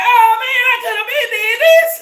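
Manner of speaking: high, loud, half-crying lament; mock regret. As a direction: wail it in playful regret. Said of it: "You're gonna be like".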